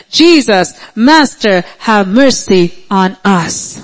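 ordinary talk, nothing marked

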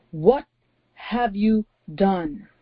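slow, grave divine reproach; God's voice with the love withdrawn; stern God. Acted. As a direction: draw each word out in solemn accusation. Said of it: "This is not the voice that came before with a lot of love".

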